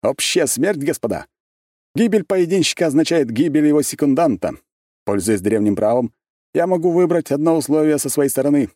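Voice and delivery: high, loud, self-important noble; pompous mayor. A narrator's reading. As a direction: lord it over everyone grandly. Prince declares terms grandly.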